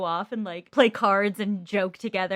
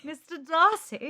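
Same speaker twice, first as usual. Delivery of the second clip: higher, slow British "Mister Darcy" falling; slowly, in a put-on British accent; hammed-up British "Mister Darcy" falling at the end; mock British accent; 7 semitones higher, slower than usual. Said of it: "you just went, "Mister Darcy".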